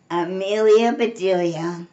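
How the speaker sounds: usual speaking voice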